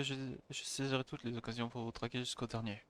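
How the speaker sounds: ordinary talk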